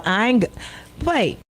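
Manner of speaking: ordinary delivery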